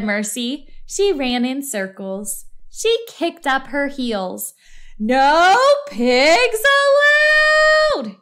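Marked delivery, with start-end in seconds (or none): 5.0-8.2 high, loud, very slow yell; angry shout. A narrator's reading. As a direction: draw out the ban at full volume. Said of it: "Eugenia shouted".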